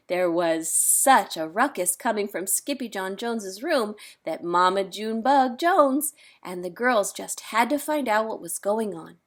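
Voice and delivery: ordinary delivery, nothing marked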